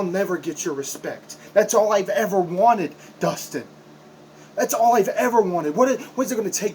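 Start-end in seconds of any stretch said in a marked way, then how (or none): 1.5-3.6 loud, higher, desperate yell; anguished shout. Acted. Wrestler shouting at his trainer for respect, loud and desperate. 4.6-5.8 loud and higher, all stressed; anguished shout. Acted. Wrestler yelling his one wish at his trainer, loud. 5.8-6.7 loud, higher, desperate yell; anguished shout. Acted. Wrestler shouting at his trainer for respect, loud and desperate.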